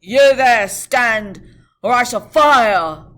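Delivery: very loud, slow threat; guard's challenge. Acted. Guard threatening the rider, very loud. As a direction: bellow the challenge.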